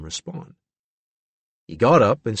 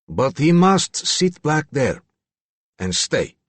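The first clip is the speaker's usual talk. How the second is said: slow, deliberate order, dropping at the end; stern character voice; commanding character; 4 semitones higher, slower than usual. A narrator's reading. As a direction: lay down the condition slowly. Regan lays down a condition.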